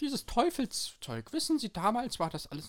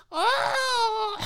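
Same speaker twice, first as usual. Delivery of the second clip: lauter hoher Aufschrei; hoher Schrei; 9 dB louder than usual. Acted. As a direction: kurz hoch aufschreien. Hüpfen. (hoher Schrei)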